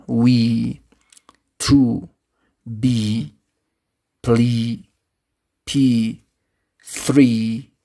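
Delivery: each of "we, to, be, plea, p, three" held long, one by one; fully long vowels. Shown as a demonstration. Teacher articulates examples the correct way, vowels held long. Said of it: "to articulate with a correct way".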